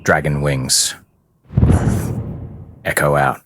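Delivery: ordinary talk, nothing marked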